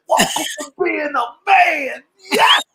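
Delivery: high, slow gleeful crowing; petty triumphant cheer; mock celebration. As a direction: gloat over the son's grumbling like a proud dad. Mock-congratulating son on road tax.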